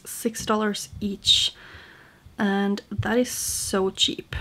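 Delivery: ordinary delivery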